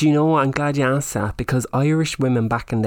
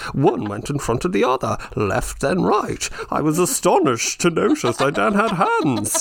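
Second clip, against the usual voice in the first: pompous writer; 6 semitones higher than usual. Retold or imitated. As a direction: parody an overwrought writer reading his prose. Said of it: "he's just like".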